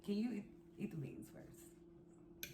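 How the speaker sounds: hushed whisper at the table. Real, not acted. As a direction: whisper the question. Quiet aside at table.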